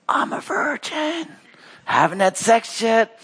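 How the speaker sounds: high, slow, stretched-out protest; hammed-up disbelief; mock bewilderment. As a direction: point out the obvious, high and drawn out. Acts Mary pausing God, bewildered.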